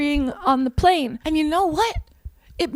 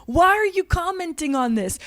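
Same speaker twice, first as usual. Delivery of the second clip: normal talking voice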